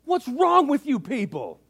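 indignant high shout, words drawn out; R C Sproul shouting. Retold or imitated. Old theologian rebuking a conference crowd, loud outraged shout. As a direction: bellow it at the whole crowd. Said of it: "As loud as he can".

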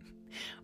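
breathy giggle; little hushed laugh; soft whispered laugh. Real, not acted.

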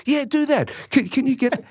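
very high, eager retold plea; eager urging. Player seizes on friend's London contact, eagerly urging. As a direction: jump on the offer, pitched right up.

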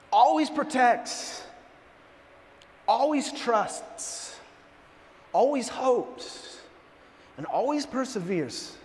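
slow, deliberate phrases with long pauses; measured scripture reading. Real, not acted. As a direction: read each promise slowly, letting it land. Reads verse slowly to congregation.

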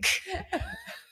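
high, helpless squeal of laughter; tearful laugh. Real, not acted. Co-host loses it over the dove, high tearful laughing. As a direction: crack up until it is nearly crying. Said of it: "now I'm starting to cry again".

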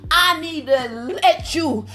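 usual speaking voice

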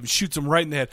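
usual speaking voice

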